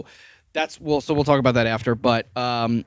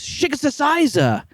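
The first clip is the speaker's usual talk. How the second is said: high, drawn-out nonsense slashing sound; imitated stabbing noise. Imitating her attack on RJ, high slashing noise. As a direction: imitate a frenzied stab in high gibberish. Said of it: "And then she goes".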